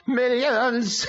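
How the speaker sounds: high demon voice breaking into laughter; laughing demon. A narrator's reading. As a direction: crow the word and laugh like a demon. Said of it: "The demon laughed uproariously".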